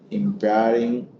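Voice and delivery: ordinary delivery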